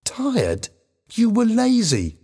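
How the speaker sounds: high-pitched accusing outburst; angry shouting. A narrator's reading. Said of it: "Helen shouted at him".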